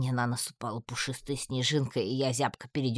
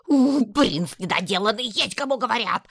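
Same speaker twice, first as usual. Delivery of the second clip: louder, higher angry yelling; furious scolding; 8 semitones higher, 6 dB louder than usual. A narrator's reading. Татьяна curses Градук prancing, loud angry yell. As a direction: berate the showing-off prince.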